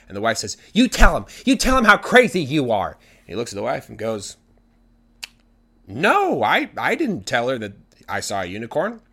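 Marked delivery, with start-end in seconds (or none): 0.7-2.9 high, loud demand; angry wife. Acted. Wife yells at husband from truck. 5.9-9.0 feigned innocent denial. Acted. Husband feigns surprise, denies it.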